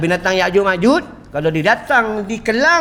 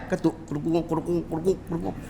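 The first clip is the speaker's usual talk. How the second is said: pounding noise; 5 semitones lower, 11 dB quieter, faster than usual. Retold or imitated. Wife pounding belacan sound.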